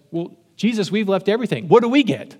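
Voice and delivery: a hint of a petulant fisherman asking for his due; mock-indignant Peter. Peter to Jesus, asking what they get, mock-indignant.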